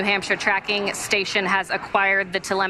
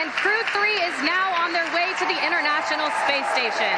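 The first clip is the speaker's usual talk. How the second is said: excited; 6 semitones higher than usual. Real, not acted. Commentator at second stage ignition.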